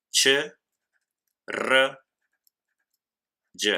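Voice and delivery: "Ch, r, y" as three single sounds, long pauses between; isolated phonemes. Shown as a demonstration. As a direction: sound out "Ch, r, y", not the letter names. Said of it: "son un sonido o fonema". ("Ch, r, y" como sonidos sueltos, no nombres de letras)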